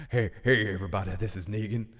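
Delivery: rough attempt at a villain accent; Negan. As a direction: introduce yourself as a swaggering bad guy. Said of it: "that's my Negan voice".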